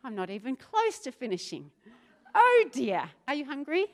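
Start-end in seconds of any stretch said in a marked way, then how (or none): none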